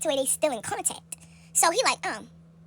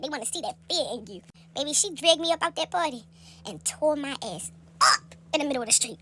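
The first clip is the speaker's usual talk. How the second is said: ordinary talk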